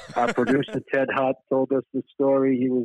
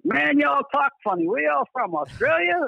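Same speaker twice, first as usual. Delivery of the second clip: put-on Southern old lady. Old lady in a Southern coffee shop to the band, mock Southern accent. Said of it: "an old lady would be like".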